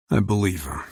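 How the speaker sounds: ordinary delivery, nothing marked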